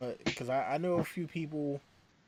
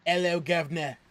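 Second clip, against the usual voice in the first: louder mock Cockney, H dropped; Cockney accent; 3 semitones higher, 5 dB louder than usual. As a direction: do a playful Cockney greeting. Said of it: "if you don't pronounce H's".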